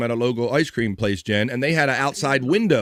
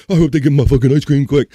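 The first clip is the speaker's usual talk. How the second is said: put-on impatient freezing kid; 8 dB louder than usual.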